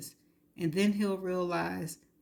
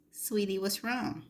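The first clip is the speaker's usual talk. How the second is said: put-on squeaky childlike voice; 2 semitones higher than usual. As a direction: mimic him like a whiny little kid. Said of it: "I mimicked him using a squeaky childlike voice".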